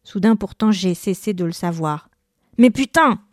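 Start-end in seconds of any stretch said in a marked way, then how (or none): 2.6-3.2 higher, louder angry cry; outburst shout. A narrator's reading. Bowler losing it after a gutter ball, shouting. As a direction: let the frustration burst out. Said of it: "Mon cri a résonné".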